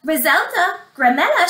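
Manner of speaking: excited mother calling out; crying out. Acted. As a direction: call the daughters in excitedly. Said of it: "she cried".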